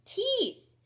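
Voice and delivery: high, loud burst of delight; gleeful storybook exclamation; excited exclamation. Acted. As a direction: shout it out like a big reveal. Exclaimed title word in story.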